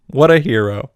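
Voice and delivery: ordinary talk, nothing marked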